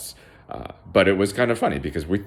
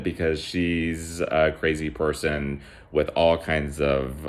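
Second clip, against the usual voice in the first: plain reading voice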